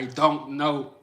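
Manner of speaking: "I don't know" spelled out word by word; careful, unslurred "I don't know"; fully pronounced "don't". Shown as a demonstration. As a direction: sound every consonant of "don't" before "know". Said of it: "We ain't gonna say".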